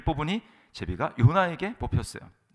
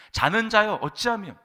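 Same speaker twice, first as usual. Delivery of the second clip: much louder, higher cry of reproach; sharp rebuke; 10 semitones higher, 9 dB louder than usual. Acted. Pagan captain reproaching sleeping Jonah, high loud rebuke. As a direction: rebuke the sleeper at raised pitch and volume. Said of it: "요나를 책망합니다".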